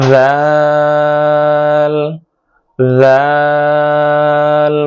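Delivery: জাল drawn out very slowly, tongue tip on the front teeth tips; demonstrating zal. Shown as a demonstration. Teacher drilling জাল, long held demonstration. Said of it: "জালটা উচ্চারণ করতে হবে".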